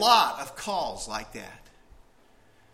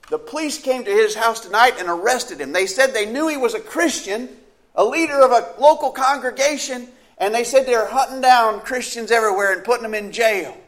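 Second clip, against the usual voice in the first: higher, louder, hurried worry; anxious report; 7 semitones higher, 5 dB louder than usual. Acted. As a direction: tell it as fearful news. Caller reporting arrest.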